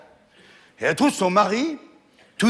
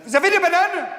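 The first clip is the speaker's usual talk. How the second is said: high chirpy little monkey; squeaky monkey; 11 semitones higher, 4 dB louder than usual. Acted. Little monkey asking the barman, high and squeaky.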